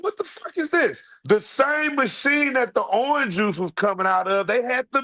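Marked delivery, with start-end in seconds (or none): 0.0-1.0 disgusted surprise. Retold or imitated. Retold disgust at machine juice.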